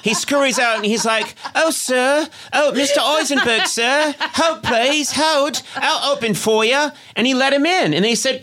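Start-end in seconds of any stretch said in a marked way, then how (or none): 1.6-6.9 high, eager Victorian lackey; scurry to serve in a mock British accent; fawning, hammed-up British errand boy; Dickensian British servant. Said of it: "this Dickensian nineteenth century British guy".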